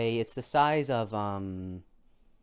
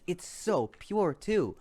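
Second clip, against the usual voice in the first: awed; 7 semitones higher, slower than usual. Acted. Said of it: "he says".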